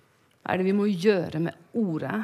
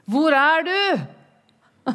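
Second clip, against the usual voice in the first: high, loud, slow call; 6 semitones higher, 4 dB louder, much slower than usual. Retold or imitated. God calling for her, high, loud and slow.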